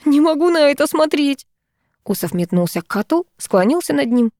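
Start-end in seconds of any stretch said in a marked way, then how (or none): 0.0-1.5 high, plaintive complaint; whimpering. A narrator's reading. Owner unable to watch cat destroy her toy, whimpering. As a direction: whimper it in a raised pitch. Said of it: "всхлипнула я".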